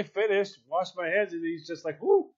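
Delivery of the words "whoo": high, loud whoop of relief; exaggerated retold whoo; mock "whew". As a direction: imitate a man's high-pitched whew. Imitates man relieving himself.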